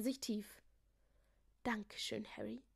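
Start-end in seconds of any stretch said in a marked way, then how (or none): 1.6-2.6 murmured thanks. A narrator's reading. Jakobus thanking the new king, soft humble murmur. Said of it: "murmelte er".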